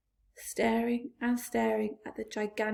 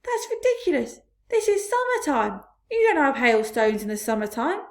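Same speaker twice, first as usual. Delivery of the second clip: 1 scoffing; 3 semitones higher, 7 dB louder than usual. A narrator's reading. Centipede scoffs loudly.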